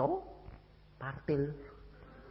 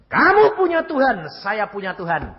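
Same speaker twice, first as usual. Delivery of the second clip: loud, higher challenge, falling; stern declaration; 10 semitones higher, 10 dB louder than usual. Retold or imitated. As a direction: declare it to the killer's face. Confronting the killer. (tegas dan lantang)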